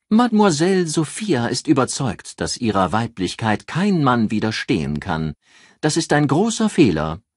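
pouting, lips pushed into a kiss; squished cheeks, pursed pout. A narrator's reading. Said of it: "schmollte mit einem Kussmund".

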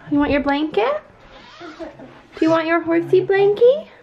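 high, slow sweet voice. Real, not acted. Mom to toddler at bedtime.

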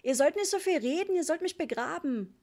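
put-on high, loud child's complaint; discontented shout. A narrator's reading. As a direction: yell it as a dissatisfied little boy. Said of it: "schrie er unzufrieden". (unzufriedenes, hohes Kindergeschrei)

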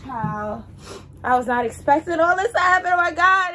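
high, loud, rushed panic; freaking out as the car gets taken; real flustered alarm; frantic panic. Real, not acted. Car being towed in rain.

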